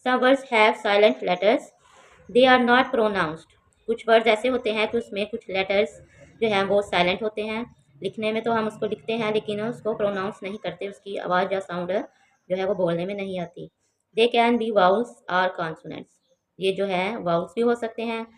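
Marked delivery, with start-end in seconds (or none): 0.1-3.5 dictation-slow textbook reading; slow careful reading. Shown as a demonstration. Teacher reads English text. 14.2-16.1 slow careful reading. Shown as a demonstration. Teacher reads English text.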